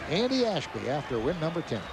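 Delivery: ordinary talk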